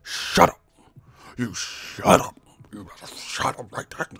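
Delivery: strained, half-voiced scolding; mock furious fan. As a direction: imitate a fan choking on rage. Mimicking furious fans scolding him.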